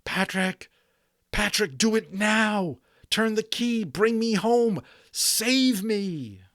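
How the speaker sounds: high, urgent pleading cry to his friend; desperate plea. A narrator's reading. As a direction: beg him to bring you home now. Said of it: "Omri began screaming silently".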